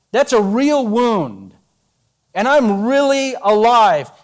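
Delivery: very slow, weighted assurance; slow insistence. Acted. Jesus convincing Thomas he lives, slow and firm. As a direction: drive home that it's real.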